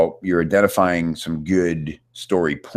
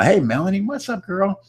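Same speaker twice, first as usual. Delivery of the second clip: playful greeting. Real, not acted. Greeting a chat member playfully.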